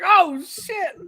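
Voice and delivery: high startled Oh, shit; startled yelp. Retold or imitated. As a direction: squeal it like you just got swooped. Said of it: "It's like".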